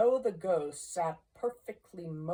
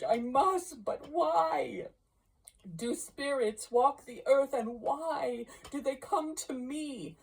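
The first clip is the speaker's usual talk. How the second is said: frightened pleading; 8 semitones higher than usual. Acted. Scrooge begging the ghost to explain, high frightened cries. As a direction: cry out in desperate fear.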